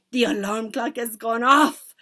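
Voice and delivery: loud, slow triumphant cry; theatrical accented shriek; witch shrieking. A narrator's reading. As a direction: call it out with drawn-out glee. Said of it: "shrieked the Grand High Witch".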